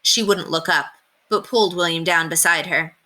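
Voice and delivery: ordinary delivery, nothing marked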